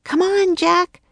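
high, urging raven voice; croaking. A narrator's reading. Annie urging Jack to follow, high croak. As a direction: urge him along in a high voice. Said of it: "croaked Annie".

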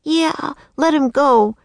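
put-on high, insistent girl's voice; defiant young girl. A narrator's reading. Girl backs up the boy against the king, high defiance. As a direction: demand it high, like a feisty child.

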